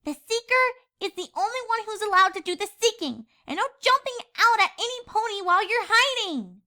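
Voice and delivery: put-on high, light young pony girl voice; a playful filly teasing her friend. A narrator's reading. Scootaloo teasing Sweetie Belle, high filly voice.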